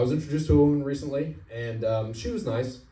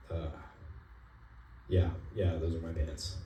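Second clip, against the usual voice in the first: sheepish admission; 5 semitones lower, 9 dB quieter than usual. Retold or imitated. Caught driver admits sheepishly.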